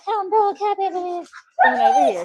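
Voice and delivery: high sing-song pet voice. Real, not acted. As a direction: coo at the dog way up high. Baby talk to the dog.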